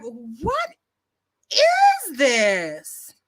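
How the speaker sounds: drawn-out, exaggerated disbelief; acted incredulity. Reacting to being censored by a commenter, incredulous.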